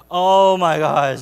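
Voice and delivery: loud, drawn-out mock exasperation; exasperated. Boy rolling his eyes at his sister, big exasperation. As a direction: drag it out slow and loud, totally fed up.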